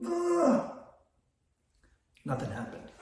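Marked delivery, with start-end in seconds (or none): 0.1-0.7 high strained groan of dread; a mock anguished ugh; pained groan. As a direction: let out a groan, bracing for doom. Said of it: "I'm just like".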